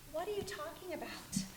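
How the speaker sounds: a sharp whisper; breathless whispered challenge; whispered retort. Acted. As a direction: hiss the question back at yourself. Inner voice argues with herself.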